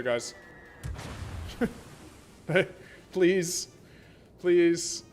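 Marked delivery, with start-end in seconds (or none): none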